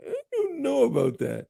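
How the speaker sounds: high wary imitation of a doubtful guest; mimicking doubtful voice. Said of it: "exactly what you were looking like".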